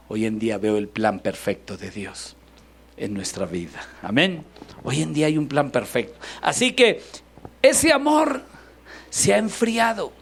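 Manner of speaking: usual speaking voice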